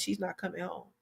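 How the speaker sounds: very soft and low, barely voiced; hushed divine whisper. Host retelling what the Lord whispered to her, hushed. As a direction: let it fall to a hush like a voice inside. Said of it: "the Lord whispered in my spirit".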